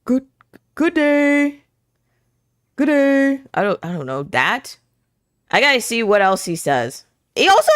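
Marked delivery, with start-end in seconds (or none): 0.1-3.4 higher gentle giant greeting; a muscly man with innocent energy; sweet muscly giant. Pretend. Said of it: "voices of muscly men who give off sweet boy, innocent energy".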